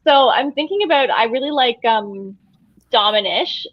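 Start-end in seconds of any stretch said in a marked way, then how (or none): none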